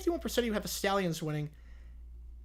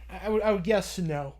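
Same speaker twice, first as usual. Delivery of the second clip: ordinary delivery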